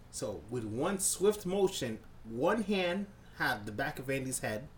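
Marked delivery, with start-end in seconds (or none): none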